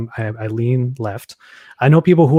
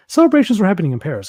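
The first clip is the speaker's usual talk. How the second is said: higher, louder and fast, as if it is big news; excited repeat; 7 semitones higher, 4 dB louder, much faster than usual. Real, not acted. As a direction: push it out quickly with delight. Emphatic repeat for listeners.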